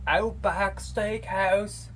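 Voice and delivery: hammed-up Australian accent, stretched out slowly; mock Australian accent. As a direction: imitate the Aussie commercial announcer in an Australian accent. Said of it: "they use, like, an Australian accent in their commercials".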